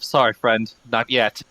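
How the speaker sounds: normal talking voice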